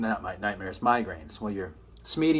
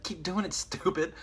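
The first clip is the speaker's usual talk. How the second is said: imitated impatient woman; 7 semitones higher than usual. Acts her impatient urging in bed.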